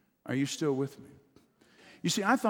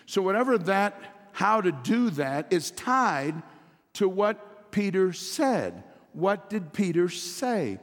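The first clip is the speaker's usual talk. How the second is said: slow, loud, higher preaching cadence; full-force sermon delivery; emphatic preaching; 6 semitones higher, 5 dB louder, slower than usual. Real, not acted. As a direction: drive each word home from the pulpit.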